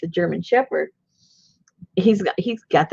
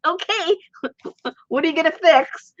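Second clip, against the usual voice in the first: high, goofy, eager voiced dog; high goofy dog voice, excited to be fixed; 11 semitones higher than usual. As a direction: play the dog bounding over happily. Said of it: "runs over like".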